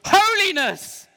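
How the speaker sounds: high, slow, loud holiness; drawn-out call. Shown as a demonstration. Poet leading the children in a slow, high holiness. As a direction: stretch the word Holiness out high. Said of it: "If you like the second one, go".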